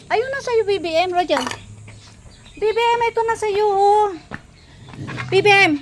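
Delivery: high, bright calling to the dogs; high sing-song call to the pets at feeding time. Real, not acted. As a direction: coax the dogs over in a high voice. Calling dogs to their food.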